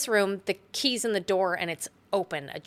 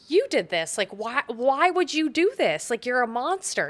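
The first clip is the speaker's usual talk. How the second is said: higher, louder accusation; mildly hammed-up accusing; accusing Wendy; 6 semitones higher, 4 dB louder than usual. Wendy confronting Jack over Danny, accusing. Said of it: "And she says".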